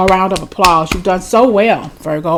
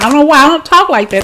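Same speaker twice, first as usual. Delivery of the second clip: amused disbelief; 8 semitones higher, 7 dB louder, faster than usual. Real, not acted. Laughs off almost swearing.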